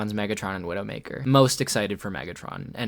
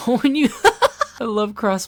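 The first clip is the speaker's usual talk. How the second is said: much higher, louder laughing squeal; delighted squeal; 12 semitones higher, 7 dB louder than usual. Real, not acted.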